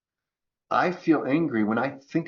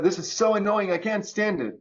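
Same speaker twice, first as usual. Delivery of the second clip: mock annoyed whine; 8 semitones higher than usual. Acting annoyed complaint.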